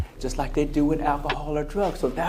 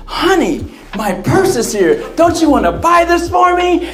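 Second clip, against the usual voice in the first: much higher and louder, gushing; excited wife; 13 semitones higher, 8 dB louder than usual. Retold or imitated. Wife begging husband to buy purse, high excited voice. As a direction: squeal with delight over the purse.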